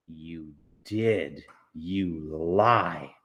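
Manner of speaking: low, firm counter; flat accusation. Acted. Amanda contradicts Mars Bar, low firm accusation. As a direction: shut him down low and certain.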